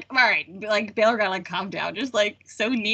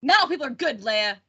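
mock cranky outburst, louder and higher; cranky old Ben; 5 semitones higher, 5 dB louder than usual. Cranky Ben scolding Leia on the mining planet, impatient mock outburst. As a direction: snap it out like an impatient old hermit. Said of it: "just being so impatient".

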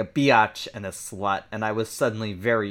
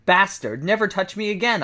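angry gasp; 8 semitones higher, 4 dB louder than usual. Acted. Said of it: "I gasped".

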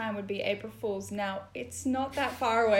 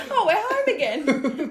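laughing, louder and higher; 6 semitones higher, 8 dB louder than usual. Real, not acted. Host laughing to cohost as the town game ends at home. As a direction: laugh through the line as the game lands home.

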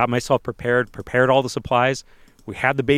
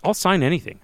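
eager; 8 semitones higher than usual. Retold or imitated. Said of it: "I'm like".

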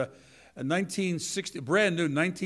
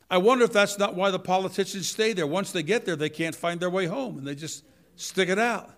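usual speaking voice

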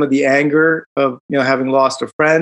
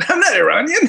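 laughing retort; 12 semitones higher, faster than usual. Retold or imitated. Retold protest, laughing high.

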